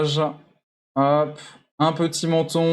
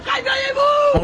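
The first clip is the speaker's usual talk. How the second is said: very high, drawn-out holler; wake-up yell. Pretend. Streamer calling out to wake the neighbours, shouting. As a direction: scream to rouse the whole building.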